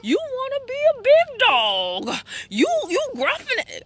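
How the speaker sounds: high, loud mocking taunt; mother teasing her angry son; teasing mom. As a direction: taunt him like he's a tough little dog. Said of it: "and I was like".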